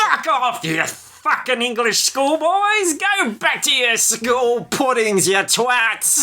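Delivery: high, slow, hammed-up barman swearing; swearing tirade. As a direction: spit out the abuse in a mock rage. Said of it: "they get told straight out".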